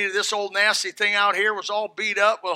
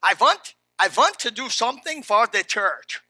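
hammed-up, louder German accent with V for W; 2 semitones higher, 4 dB louder than usual. Pastor retrying the old German man's offer, hammed-up German accent. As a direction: lean hard into a badly faked German accent. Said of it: "That's a terrible German accent".

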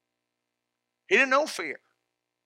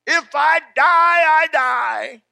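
loud, high, slow declaration; shouted vow; 6 semitones higher, 8 dB louder than usual. Acted. Preacher shouting a believer's vow to the congregation, loud and high.

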